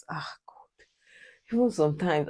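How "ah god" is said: whispered sigh of exasperation; exasperated whisper. Real, not acted. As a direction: breathe it out, fed up. Exasperated aside after mom quote.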